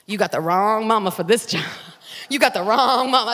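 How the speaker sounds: low, loud warning from a mother; sassy mama. Retold or imitated. Mother warning her ungrateful preteen daughter, firm. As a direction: put your foot down, mama style.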